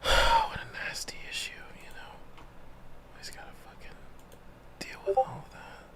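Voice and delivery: annoyed whispered aside; whispering. Real, not acted. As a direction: grumble it under your breath. Annoyed aside about audio issue.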